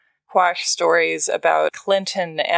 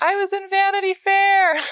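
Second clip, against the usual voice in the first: mock proud shout; 13 semitones higher than usual. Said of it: "and then be like".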